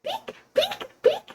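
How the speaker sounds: tiny high-pitched squeaky peeps; playful peeks. Pretend. Playing the game Peek for chat.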